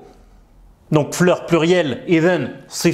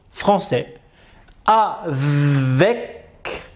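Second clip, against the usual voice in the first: halting reading; slower than usual. Pretend. Student stumbling through a new sentence, slow and halting. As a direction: read like a hesitant learner. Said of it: "Après, je t'explique".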